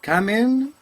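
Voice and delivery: high loud come in; calling out. Acted. Loud rising call to a visitor at the door.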